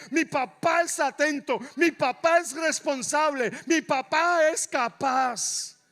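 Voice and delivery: loud, rising preacher's cry; full-force preaching. Real, not acted. Preacher to congregation about his Father listening, full-force preaching.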